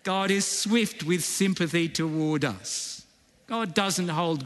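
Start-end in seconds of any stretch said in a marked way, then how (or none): none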